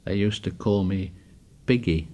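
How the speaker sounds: low, softened confession; a touch of a whisper, still voiced; mild whisper. A narrator's reading. Piggy tells Ralph his old school nickname, whispering lightly. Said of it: "He whispered".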